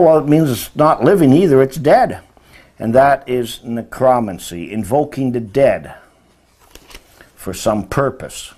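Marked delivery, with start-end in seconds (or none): none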